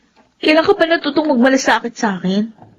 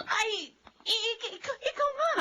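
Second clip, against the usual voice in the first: startled stammer; 6 semitones higher, 15 dB quieter than usual. Acted. Delia startled by Mildred.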